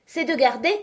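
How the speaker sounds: declaim, then stop dead; loud voice. A narrator's reading. Knight proclaiming before falling: loud. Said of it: "d'une voix forte".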